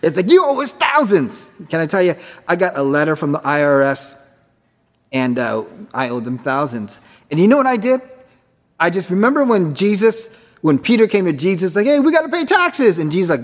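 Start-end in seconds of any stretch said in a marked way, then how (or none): 0.3-1.5 higher, mock-stern demand; mock IRS demand. Preacher mocking the IRS letter, high mock-stern demand. 11.9-13.0 higher, anxious little voice; worried Peter. Retold or imitated. Preacher voicing Peter to Jesus, high worried plea.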